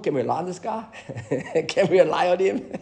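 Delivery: laughing through the question; amused skeptical question. Retold or imitated. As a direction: chuckle as you ask it. Laughing retelling a doubt.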